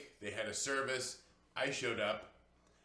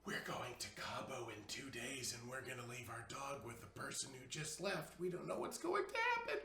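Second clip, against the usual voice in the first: harried family; 9 dB quieter than usual. Retold or imitated. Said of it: "harried moments of".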